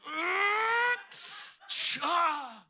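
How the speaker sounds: long high, loud straining yell, then a shouted release; hammed-up slow-motion heroic yell; strained effortful cry. God deflecting a bullet in slow motion, strained cry. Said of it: "That took a lot out of me".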